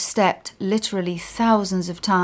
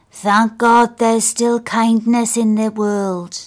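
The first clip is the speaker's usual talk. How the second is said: put-on slower, louder voice for an old man; old man's gratitude; 3 semitones higher, 5 dB louder, slower than usual. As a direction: give the old man's thanks weight, slowly. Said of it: "he says".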